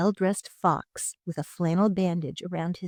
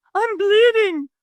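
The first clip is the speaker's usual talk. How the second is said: much higher, louder yelp; 9 dB louder, slower than usual. A narrator's reading. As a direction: yelp in alarm. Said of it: "the fox yelped".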